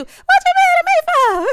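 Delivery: very high, loud mock-innocent squeal; loud squawking retort. Guest squealing back at the annoyed bride, very high and loud. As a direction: fire it back shrill and outraged. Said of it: "mad at you".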